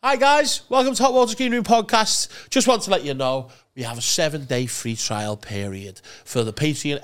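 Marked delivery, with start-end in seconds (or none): 0.0-4.0 promo read. Acted. Podcast promo intro.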